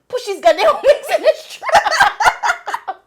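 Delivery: high, loud and slow, cracking up mid-line; laughing quote. Retold or imitated. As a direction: quote the cop while bursting into laughter. Mimics cop, laughing.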